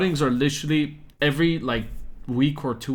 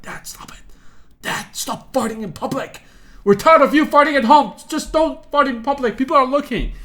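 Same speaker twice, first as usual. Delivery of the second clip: pleading with dad; 11 semitones higher, 7 dB louder than usual. Retold or imitated. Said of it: "I'm like".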